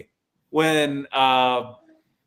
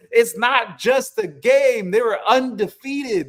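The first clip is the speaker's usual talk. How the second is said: higher, louder and slow, defending the team; passionate protest; 8 semitones higher, 4 dB louder than usual. Retold or imitated. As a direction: insist it matters, slowly. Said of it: "And I said".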